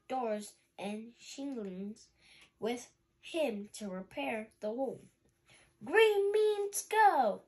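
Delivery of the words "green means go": high, loud, slow howl; howled catchphrase. Acted. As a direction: howl the motto out. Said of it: "Rocky howled".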